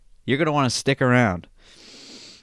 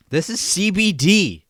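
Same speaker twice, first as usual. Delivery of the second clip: announcer voice; 6 semitones higher, slower than usual. Shown as a demonstration. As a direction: sell it like a TV announcer. Two takes of same line.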